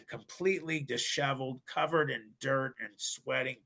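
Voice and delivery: ordinary delivery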